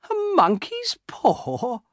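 very high, slow, incredulous question; amused disbelief. A narrator's reading. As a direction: echo it high and stretched, mocking. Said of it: "and he laughed".

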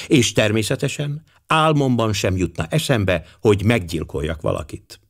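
usual speaking voice

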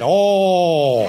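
loud hurt cow groan. Retold or imitated. Cow hooked in the face by the wife's cast, a loud groan. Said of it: "what the cow said".

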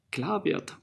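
ordinary delivery

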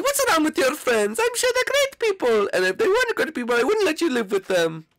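put-on very high, doting old mother voice; Mama Luigi voice. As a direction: coo like an overbearing mom on the phone. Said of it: "it's me, Mama Luigi".

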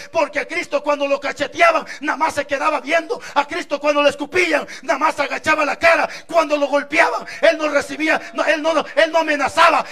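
fuerte y cada vez más alto; predicación a toda voz. Acted. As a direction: sube la fuerza frase a frase. Predicación creciente. (el predicador exalta la humildad de Cristo a gritos)

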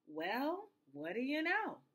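rising, wondering exclamation; amazed cry. A narrator's reading. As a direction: let pleasant surprise lift the pitch. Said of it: "cried the elephant".

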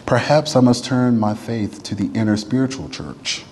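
ordinary talk, nothing marked

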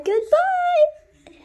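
very high, loud sung-out goodbye; shrieked goodbye. Pretend. A child shoos the game character away with a shrill goodbye.

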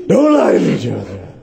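over-the-top vigilante interrogation; Batman. Acted. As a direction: bark it like a masked hero, hammed up. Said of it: "My, my Batman?".

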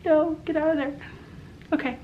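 foam mask around mouth; wiping foam off mouth; speaking through foam on lips. Real, not acted. Seen 2: Bubbling foam covers face around open lips; towel wipes mouth mid-clip.

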